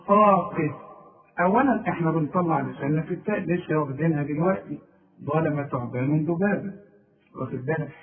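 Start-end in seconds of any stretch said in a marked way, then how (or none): none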